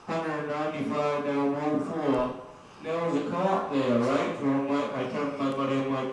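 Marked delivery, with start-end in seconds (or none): none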